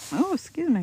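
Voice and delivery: right after a hiccup; jumpy pitch from a hiccup. Real, not acted. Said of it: "I got hiccups".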